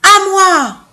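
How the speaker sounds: loud, high bawl; bawling claim. Acted. Second elephant bawling his claim to the fruit, loud. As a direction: bellow it out greedily. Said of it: "brailla le deuxième éléphant".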